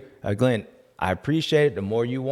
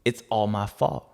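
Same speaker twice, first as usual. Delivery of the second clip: ordinary talk, nothing marked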